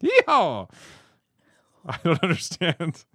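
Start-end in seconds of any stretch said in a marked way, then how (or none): 0.0-0.7 high, loud yeehaw; cowboy yell. Pretend. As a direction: whoop it like a rodeo cowboy. Cowboy yell about guns.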